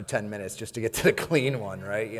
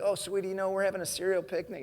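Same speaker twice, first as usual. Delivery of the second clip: higher, sugary sweet reassurance; sweet sister; 8 semitones higher than usual. Retold or imitated. Sister sweetly explaining the picnic to the old lady. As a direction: chirp it like a cheerful mom.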